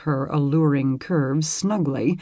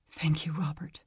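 soft unvoiced whisper; 10 dB quieter than usual. A narrator's reading. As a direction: breathe it tenderly. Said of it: "she whispered".

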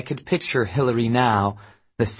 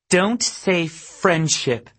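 angry grin; 8 semitones higher, much slower than usual. A narrator's reading. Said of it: "an angry grin in her voice".

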